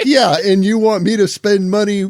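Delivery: loud, scoffing comeback; sarcastic retort. Retold or imitated. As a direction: throw it back at him, mocking. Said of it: "It's like".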